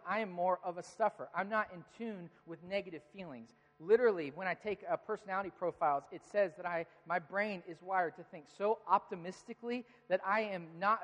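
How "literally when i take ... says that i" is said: ordinary delivery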